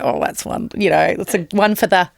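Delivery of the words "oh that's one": breathy whisper to herself; whispered aside. Retold or imitated. Filing away a new voice, in a whisper. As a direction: whisper it like a secret note. Noting a voice to copy.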